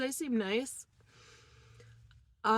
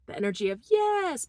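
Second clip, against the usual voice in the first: ordinary delivery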